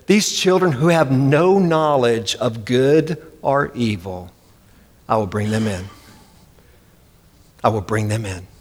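low, hushed, reassuring God voice; tender voice of God. Acted. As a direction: speak it gently, like a father reassuring. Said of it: "and this is how he said it".

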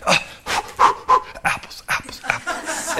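whispered mock grunting, pushing out apples; mock straining tree. Pastor mocks a tree straining to make apples, whispered grunt. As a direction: strain like a tree forcing out fruit. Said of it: "grunt and grind and".

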